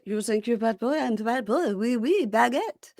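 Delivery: playfully mock a cocky Frenchman with a French accent. Co-host joins the joke, light mock French accent. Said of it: "in my French little accent".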